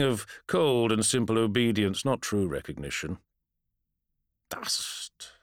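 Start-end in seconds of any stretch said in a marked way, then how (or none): 4.5-5.3 dry unvoiced whisper. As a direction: breathe the word like ash. Undead warrior speaks hollowly.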